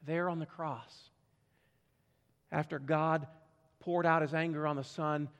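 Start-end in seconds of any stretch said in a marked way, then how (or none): none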